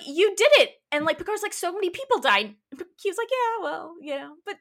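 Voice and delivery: high, loud, glib Q impression; mock Q voice. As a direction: toss it off like an all-powerful being. Retelling Q's glib reply.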